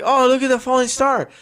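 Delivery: high, bright excited call of "Oh"; excited kids. Retold or imitated. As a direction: point it out like a thrilled kid. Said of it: "They're like".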